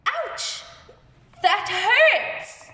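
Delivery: high, pained yelp; acted ouch for a class; hurt. Shown as a demonstration. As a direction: cry out as if in pain. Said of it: "I am very sad".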